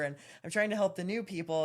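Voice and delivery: ordinary delivery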